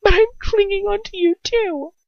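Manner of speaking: high, loud, tearful pleading; sobbing. A narrator's reading. Hermione pleading with Ron through tears, sobbing. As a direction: beg through sobs. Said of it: "I sob".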